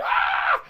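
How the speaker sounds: mock scream of a hurt child; imitated scream. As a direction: imitate a kid screaming in pain. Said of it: "And he just screams".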